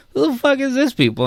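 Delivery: high-pitched, incredulous mock outburst; baffled reaction. Podcaster finding his show on unknown platforms, mock bafflement. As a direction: play up the bewilderment.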